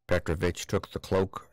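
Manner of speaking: ordinary delivery, nothing marked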